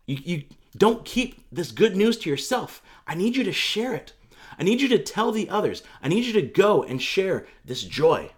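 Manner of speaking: heartfelt charge to go; earnest urging. Retold or imitated. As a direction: urge someone on, leaning on each request. Voicing Jesus urging Mary to go.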